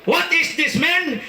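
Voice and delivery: high put-on American question; mock American asking. American man asking what he ate, high put-on accent. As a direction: quiz him casually, American style.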